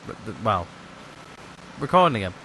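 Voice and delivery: hiccuping mid-sentence; hiccups. Real, not acted. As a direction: talk through the hiccups. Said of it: "I've got the hiccups".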